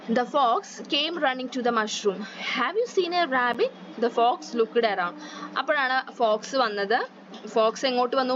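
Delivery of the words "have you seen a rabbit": sly fox. A narrator's reading. Fox asks the animals.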